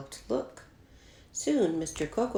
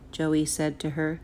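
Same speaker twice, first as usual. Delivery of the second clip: usual speaking voice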